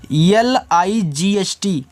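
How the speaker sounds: एल आई जी एच टी letter by letter; slow spelling. Shown as a demonstration. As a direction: spell out each letter slowly. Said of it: "इसको light पढ़ना है". (धीरे धीरे spelling)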